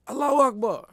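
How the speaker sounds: high, ringing cry of faith under the stones; screamed Allahu Akbar. Retold or imitated. Slave under stones crying out his faith, high. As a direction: call it out high like a martyr's cry. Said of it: "he would scream out".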